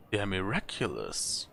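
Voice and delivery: soft, low murmur of wonder; breathed in awe. Acted. Traveller marvelling at the relit candles, soft awe. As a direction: say it softly, awed. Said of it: "I breathed".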